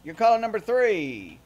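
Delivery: excited caller countdown; radio contest promo. Acted. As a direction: DJ calling out caller numbers.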